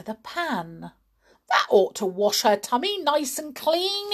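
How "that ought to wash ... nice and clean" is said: put-on gleeful boy. A narrator's reading. George's gleeful line.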